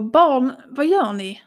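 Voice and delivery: loud frantic shout through the house; mother shouting for her hiding kids. Retold or imitated. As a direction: yell for the children, scared. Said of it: "Jag skriker".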